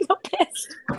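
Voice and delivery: ordinary talk, nothing marked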